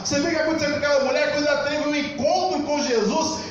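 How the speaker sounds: high-pitched, driving sermon delivery; fervent preaching. Real, not acted. Preacher telling of the woman who ran to tell everyone, fervent preaching. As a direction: preach it in one excited, rising rush.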